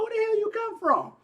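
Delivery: high, startled yelp of disbelief; shocked outburst. Retold or imitated. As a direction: jump up in pitch like she appeared from nowhere. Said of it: "I'm like".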